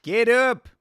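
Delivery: loud Australian get up; mock Australian shout; Australian accent. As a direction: bark it like an Aussie corner man. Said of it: "tell him to get up in his accent".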